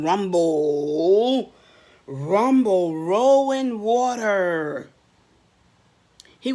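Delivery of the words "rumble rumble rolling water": loud, booming rumble; rumbling water. Acted. Reading to kids, booming river rumble. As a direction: roll it out big like a river.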